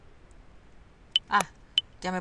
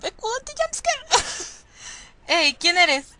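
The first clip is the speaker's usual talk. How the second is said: put-on frightened boy calling out, louder; scared boy; 10 semitones higher, 5 dB louder than usual. Boy finds someone in the morgue, scared call. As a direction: call into the dark, afraid.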